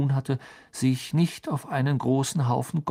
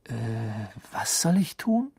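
stammering. A narrator's reading. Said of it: "stammelte er".

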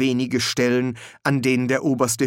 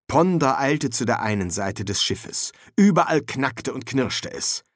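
usual speaking voice

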